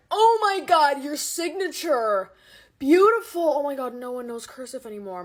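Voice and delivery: loud, high rave; gushing clerk. Retold or imitated. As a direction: gush over the signature. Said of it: "she was like".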